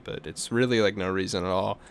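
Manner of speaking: normal talking voice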